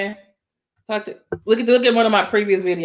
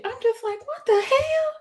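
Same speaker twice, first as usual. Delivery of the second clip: soft, very high squeak of disbelief; small incredulous squeal; high-pitched excitement; 12 semitones higher, 6 dB quieter than usual. Real, not acted. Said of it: "I get higher when I get excited".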